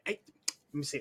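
ordinary talk

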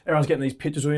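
usual speaking voice

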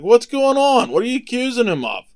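high-pitched, acted official demanding answers; demanding questioning. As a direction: play Pilate coming out to question the crowd. Pilate questions the accusers.

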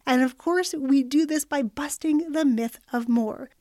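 normal talking voice

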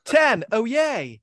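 high, drawn-up mock celebration; mock happy. Host reveals the happy killer pun, mock happy. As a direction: cheer it high as a silly punchline. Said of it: "and he's happy".

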